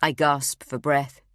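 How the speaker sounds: plain reading voice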